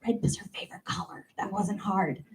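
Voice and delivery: fast unvoiced whispered aside. Aside to audience.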